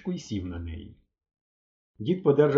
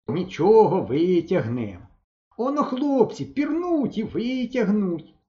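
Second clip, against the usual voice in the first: put-on reassuring old man; 8 semitones higher, 3 dB louder, slower than usual. A narrator's reading. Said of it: "дід почав заспокоювати".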